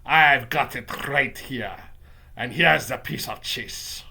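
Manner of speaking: slow, gloating witch voice; accented witch, drawn-out and menacing; Grand High Witch yelling. Acted. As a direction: relish it as an accented villain witch. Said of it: "I heard the Grand High Witch yelling".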